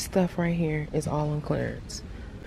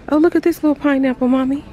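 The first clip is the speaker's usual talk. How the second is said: excited call; 8 semitones higher, 6 dB louder, faster than usual. Real, not acted. Calling out to mom excitedly.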